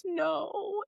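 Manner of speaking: put-on high, soft small-child voice; sad little boy. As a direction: answer like a teary five-year-old. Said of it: "And he goes".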